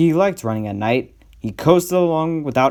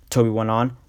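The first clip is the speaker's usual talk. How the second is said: ordinary delivery, nothing marked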